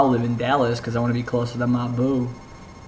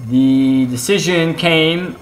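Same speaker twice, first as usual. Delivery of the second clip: dramatic announcer; 4 semitones higher, 6 dB louder, much slower than usual. Pretend. Dramatic storytelling opener.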